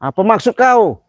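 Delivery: calling out the unseen coward; furious shout. Real, not acted. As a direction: bellow a challenge into the dark. Shouting at spirit.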